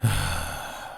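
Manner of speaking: long exhaled sigh; breathy, drawn-out sigh. Acted. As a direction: let out a long, falling sigh. Long sigh during interrogation.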